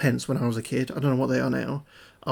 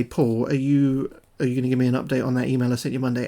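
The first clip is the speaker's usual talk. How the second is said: hurried. Retold or imitated. Trying to ask Paul before he hangs up, rushing. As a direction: squeeze the question in fast.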